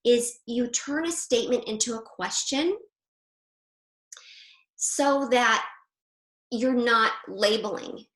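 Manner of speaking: ordinary talk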